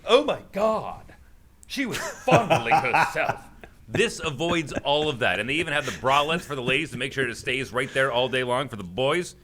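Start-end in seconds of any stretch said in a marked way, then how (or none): 0.1-3.4 mock shocked guy. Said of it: "no guy goes".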